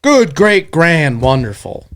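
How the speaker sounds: dry sarcastic list, falling off; mock cheer that sinks word by word. Real, not acted. Sarcastic reaction to lost pick.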